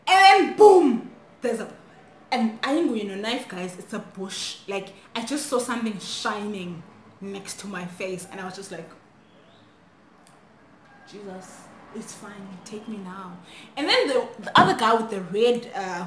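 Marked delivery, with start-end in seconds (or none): 0.1-1.1 loud, high, drawn-out exclamation; sudden boom. Retold or imitated. Storyteller showing the knife suddenly appearing, explosive. 11.1-13.4 hushed, much softer surrender; resigned prayer. Retold or imitated. Girl facing a knife by her face, quietly resigned.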